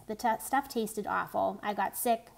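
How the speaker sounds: plain reading voice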